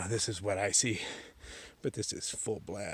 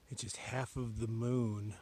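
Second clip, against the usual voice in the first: normal talking voice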